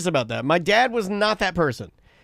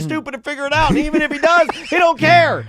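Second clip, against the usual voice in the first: higher-pitched, insistent urging; conspiring insistence; 9 semitones higher than usual. Acted. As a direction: push the plan in a raised voice. Mom scheming to trick dad.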